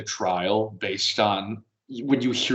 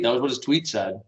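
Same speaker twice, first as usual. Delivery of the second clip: ordinary delivery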